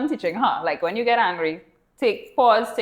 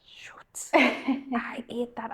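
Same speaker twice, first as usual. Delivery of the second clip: whispered oops; 8 dB quieter, much slower than usual. Retold or imitated. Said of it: "And I was like".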